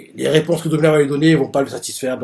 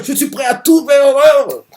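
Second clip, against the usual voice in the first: a much higher, louder vow, shouted out; fervent shout; 11 semitones higher, 7 dB louder than usual. Retold or imitated. Domina swearing to win his father's love, shouting. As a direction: yell the vow like a fanatic son.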